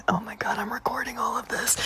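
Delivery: hushed, whispered aside; secret whisper. Retold or imitated. Trickster secretly recording the man, gleeful whisper. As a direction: whisper it so the mark can't hear.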